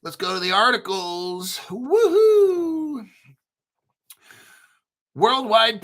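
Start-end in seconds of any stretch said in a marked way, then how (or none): 1.7-3.1 excited cheer. Real, not acted. Cheering before the articles.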